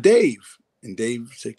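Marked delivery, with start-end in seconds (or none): 0.0-0.4 calling out. Retold or imitated. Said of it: "So I said".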